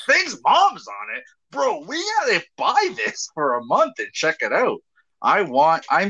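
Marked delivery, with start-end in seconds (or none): 0.1-4.8 high-pitched, hammed-up incredulous reaction; excited mock disbelief. Host acting out his reaction to gossip about a mom, high mock excitement. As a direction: squeal it like a scandalized, eager buddy. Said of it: "I'd be like".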